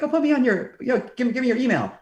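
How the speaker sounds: friendly request. Retold or imitated. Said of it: "And he was like".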